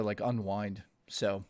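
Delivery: ordinary talk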